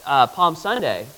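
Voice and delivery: ordinary talk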